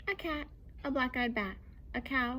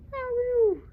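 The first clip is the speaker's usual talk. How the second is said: cat yowl; 13 semitones higher, 9 dB louder than usual. Acted. Cat sound repeated.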